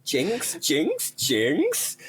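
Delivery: high, rising playful calls; singsong, teasing high voice; playful high jinx. Pretend. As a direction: leap up high in pitch on each word.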